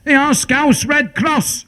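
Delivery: high, slow call up the mountain; a drawn-out shout from below, pitched high. Retold or imitated. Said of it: "shouted up".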